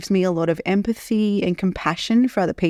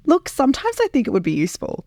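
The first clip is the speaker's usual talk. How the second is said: crying; 8 semitones higher, faster than usual. Real, not acted. Tearful laugh after crying.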